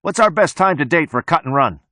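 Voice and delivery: high-pitched call over the shoulder; calling back. A narrator's reading. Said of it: "The mechanic calls back over his shoulder".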